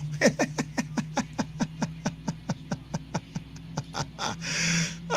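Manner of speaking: breathless, near-silent laugh; airy laughing fit; silent wheezing laugh. Real, not acted. Laughing at mad fan.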